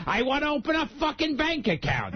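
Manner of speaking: mock-indignant, high-pitched repeat; insistent customer. Customer insists after teller's warning.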